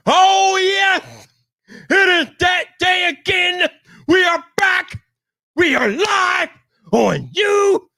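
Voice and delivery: hype shout. Real, not acted. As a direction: blast it like a hype man opening the show. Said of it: "don't blow you guys' ears off".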